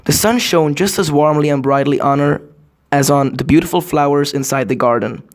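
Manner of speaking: ordinary delivery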